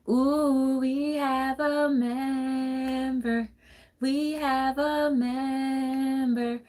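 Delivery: high, slow delighted greeting; slow high-pitched welcome to new viewers; excited welcome. Real, not acted. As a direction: stretch it out, thrilled someone joined. Greets viewers joining live.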